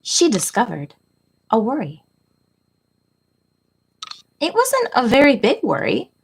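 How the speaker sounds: put-on reading to children. A narrator's reading. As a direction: read it like storytime for little kids. Reads picture book to class.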